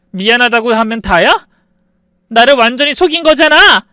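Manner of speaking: high, loud furious shout; furious outburst. Acted. 속은 아내가 남편에게 분노해 높고 크게 소리침. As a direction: scream the betrayal at him. Wife confronts lying husband.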